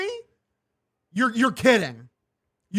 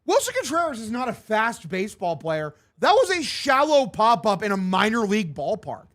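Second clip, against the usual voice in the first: usual speaking voice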